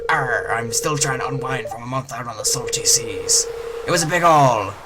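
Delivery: little pirate voice. Acted. Gamer voicing the fisherman greeting the newcomer as a pirate. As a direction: growl it out like a friendly old pirate. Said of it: "Little pirate voice".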